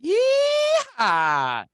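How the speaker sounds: loud high cowboy whoop; yeehaw. Acted. Host greets Texas with a loud cowboy yeehaw. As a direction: holler it like a rodeo cowboy.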